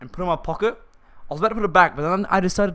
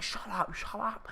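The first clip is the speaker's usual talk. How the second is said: hushed, hissed warning; quiet him down fast; urgent hush; 6 semitones higher, 9 dB quieter, slower than usual. Retold or imitated. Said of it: "He's like".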